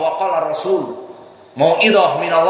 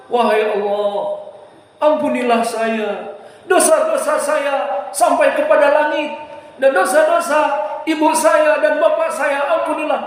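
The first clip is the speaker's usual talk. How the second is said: lightly voiced pleading prayer, rising high; a hint of the crying-prayer tune; imitating a tearful prayer; 6 semitones higher than usual. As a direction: mimic the preacher's weeping plea. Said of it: "dari sistem susunan intonasi".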